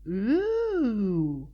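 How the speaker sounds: long awed ooh; entranced ooh. A narrator's reading. Pinky seeing the portal: awed drawn-out ooh. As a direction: marvel at the portal. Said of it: "staring entranced at the portal".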